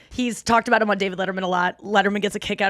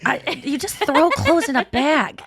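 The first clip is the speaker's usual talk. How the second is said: mock husband; 5 semitones higher, slower than usual. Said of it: "He goes".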